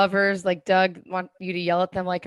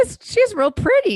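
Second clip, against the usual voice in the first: slow high twangy hillbilly drawl; Appalachian accent; 5 dB louder than usual. Retold or imitated. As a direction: lay on a thick Appalachian twang. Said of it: "I sound like a hillbilly".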